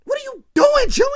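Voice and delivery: screaming at him in alarm; high alarmed shout. Retold or imitated. Sister screaming at her brother in disbelief. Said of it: "she like".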